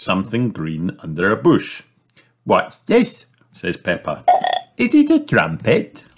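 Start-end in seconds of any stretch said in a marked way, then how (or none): 2.4-6.0 put-on very high curious girl; puzzled little piglet; Peppa's voice. A narrator's reading. Sister finding something under a bush, high curious voice.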